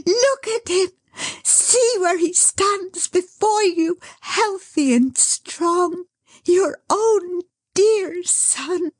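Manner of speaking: high, slow joyful near-crying; tearful ecstasy. Acted. Dot presenting the son to Caleb and Bertha, tearful ecstasy. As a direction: cry out in joy through tears. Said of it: "in ecstasy".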